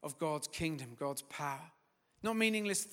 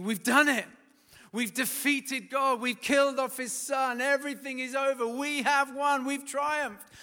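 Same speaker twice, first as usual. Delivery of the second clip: high, loud, triumphant jeering; gloating demons; 8 semitones higher, 8 dB louder than usual. Acted. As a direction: crow over the victory like gleeful demons. Hell gloating over Christ's death.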